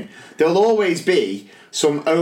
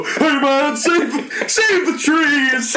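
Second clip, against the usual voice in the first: high-pitched, drawn-out hippie plea; hammed-up eco-protester voice; mock hippie; 10 semitones higher, much slower than usual. Teacher in a bandana pleading over blank pages, mock hippie. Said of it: "I put my bandana on and go".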